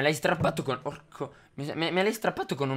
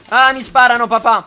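much higher and louder, alarmed cry; alarmed shout; 9 semitones higher, 14 dB louder than usual. Real, not acted. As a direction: yell for help as you get shot. Son shot at in game.

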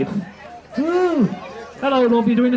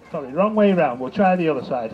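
normal talking voice

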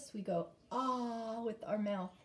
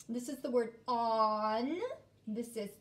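normal talking voice

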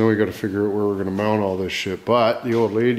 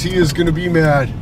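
"He is gonna be mad" with "is" punched, higher and louder; stress on is; 5 semitones higher, 4 dB louder than usual. Acted. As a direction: hit the word "is" hard and loud. Repeated line, muttered then stressed.

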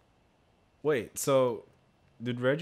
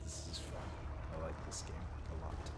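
quiet; 7 semitones lower, 10 dB quieter, much faster than usual. Real, not acted. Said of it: "I'm genuinely having fun".

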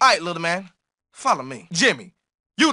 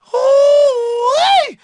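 high, loud whooping holler; excited whoop; 6 dB louder than usual. Acted. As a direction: throw out a big delighted whoop. Brawl bark, excited whoop.

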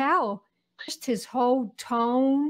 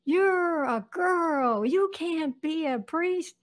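put-on higher, slow boyish retort; little boy voice; 5 semitones higher, slower than usual. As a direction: imitate a bossy young brother. Brother's retort, retold.

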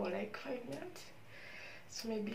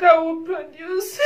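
loud, crying declaration; real tearful joy; tearful triumph; 6 semitones higher, 16 dB louder than usual. Real, not acted. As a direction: cry it out with conviction.

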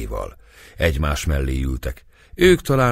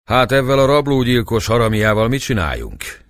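higher, louder call after someone; 7 semitones higher, 6 dB louder than usual. A narrator's reading. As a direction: shout the question after Dobó. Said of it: "kiáltotta Dobó után a cigány".

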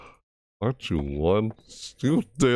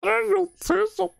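very high panicked protest; freaked-out wailing; slower than usual. Shown as a demonstration. Player showing how game gets them, freaked-out wail.

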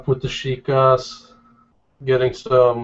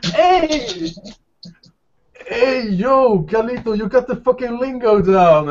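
much higher, whooping with glee; delighted shout; 11 semitones higher than usual. Acted. Javier whoops at Joshua for knowing the lingo. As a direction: cheer him on like a proud friend.

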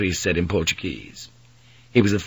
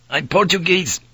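high-pitched indignant retort; flaring up; 9 semitones higher than usual. A narrator's reading. Priest taken for a Spaniard, flaring indignantly. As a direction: snap back, stung by the insult. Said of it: "the priest flared, taking the bait".

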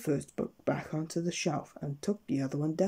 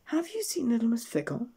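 higher, worried asking; anxious question; 9 semitones higher than usual. A narrator's reading. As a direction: ask with rising worry for a missing friend. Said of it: "she asked anxiously".

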